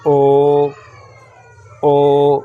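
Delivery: "ओ" said short and loud, never stretched; short o sound. Shown as a demonstration. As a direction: snap out a quick "ओ", no length. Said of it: "ओ मतलब लंबा नहीं करना, short में". ("ओ" छोटा और ज़ोर से, खींचना नहीं)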